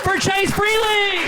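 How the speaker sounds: high, drawn-out hype shout; announcer hype. Real, not acted. Comic hyping the crowd for the next act, big drawn-out shout. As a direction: sell the name to the crowd.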